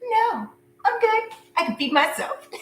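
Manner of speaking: a laughing sarcastic brush-off. Pretend. Turning down the ex's dinner invite, sarcastically high. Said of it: "And you're like".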